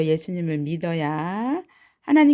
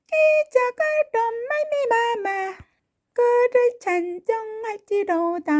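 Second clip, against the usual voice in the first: put-on high, lisping baby voice; 혀 짧은 아기처럼 아주 높게; 아기 목소리; 12 semitones higher than usual. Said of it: "아기 목소리로 한번 불러볼까요". (아주 높은 아기 목소리)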